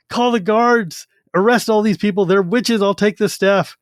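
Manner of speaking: high, ranting heretic accusation; mock angry accusation. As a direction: rant at them like a drunk zealot. Drunk theocrat demands arrest.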